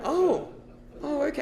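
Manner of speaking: soft, high acted "oh"; small, sheepish acknowledgement; awkward realisation. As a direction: let it sink in gently, embarrassed. Awkward reaction to sailor coming out.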